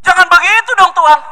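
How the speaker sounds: high, loud complaining wife; protesting wife. Acted. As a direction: whine it up high at God. (istri merengek protes, suara tinggi dan keras)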